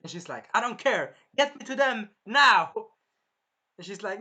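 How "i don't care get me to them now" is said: louder, higher, building to a command; insistent demand. Acted. Melty insisting to Risha, loud rising demand. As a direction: bark the order, growing louder.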